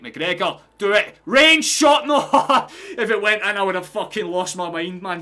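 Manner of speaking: real high, very loud outburst at a near miss; live match roar, high-pitched; excited shout. Real, not acted. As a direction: yell as the shot goes, then despair of it loudly.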